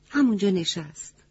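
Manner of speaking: plain reading voice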